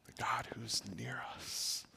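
soft unvoiced whisper; God whispering love to his child; gentle whisper. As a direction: lean in and whisper tenderly. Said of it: "the gentle whisper".

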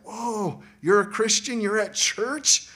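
high, amazed greeting, slowing at the end; delighted disbelief; stoked surprise. Retold or imitated. Friend at school greeting the brother, amazed.

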